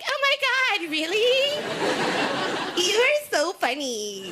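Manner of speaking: high, squealing ditzy delight; fake dumb gushing. Woman faking interest on a boring date, high squealing gush. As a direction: play dumb and gush at him. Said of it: "You're just like".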